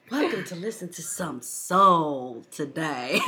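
slow, drawn-out parody of a radio show hostess; mock radio lady. Co-host teasing the host's intro, mock radio lady. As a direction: tease with an exaggerated, slow radio-host welcome. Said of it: "like the lady that comes out in the radio".

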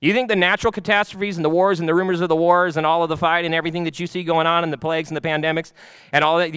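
ordinary talk

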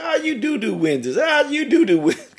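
high, loud mocking voice of the lady. Retelling how the lady mocked his flyer, high and mocking. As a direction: sneer it back like the scornful lady. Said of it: "She said".

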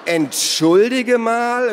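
very high, loud, slow outraged protest; indignant. Acted. Naru protests being wagered, indignant. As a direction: stretch it out, outraged. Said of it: "rief Naru empört".

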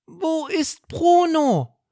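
hohe, laute, langsame Tierstimme; Barney fragt. Acted. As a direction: frag besorgt mit hoher Fabelstimme. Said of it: "fragt Barney". (Barney fragt nach Bruno, hoch und gedehnt)

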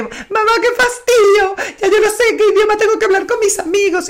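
put-on high, whiny teenage complaint; annoyed teen daughter. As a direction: whine like a fed-up teenager. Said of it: "Fabiana un día llegó arrecha". (imitando a una hija adolescente fastidiada, aguda y quejona)

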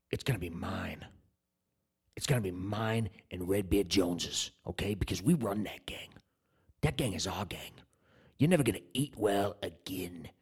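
low, hushed gang-boss menace; menacing claim. Acted. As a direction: drop low and soft to stake your claim. Prison threat, claiming his food.

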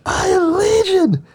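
high, drawn-out mock scream; imitated scream. Retelling a man screaming during prayer, imitated scream. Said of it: "he screams out".

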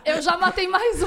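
laughing through the line. Real, not acted. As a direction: blurt it out mid-laugh. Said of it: "eu tô tentando até falar pausado".

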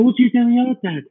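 put-on high fast curious child. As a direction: squeak it like a little kid. Child asking question.

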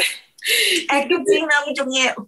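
very slow, tearful words; teary. Real, not acted. Answering her husband on the show, holding back real tears. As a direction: speak slowly while holding back tears. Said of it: "Hasta yo quise llorar".

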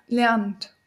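ordinary talk

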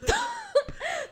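helpless high-pitched giggle fit; high squealing laugh. Real, not acted. Telling friends about drinking, squealing with laughter. Cracking up at own story.

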